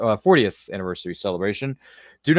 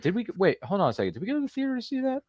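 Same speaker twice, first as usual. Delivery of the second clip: sudden doubt; 12 semitones higher, 6 dB quieter, faster than usual. Real, not acted. Suddenly questioning memory.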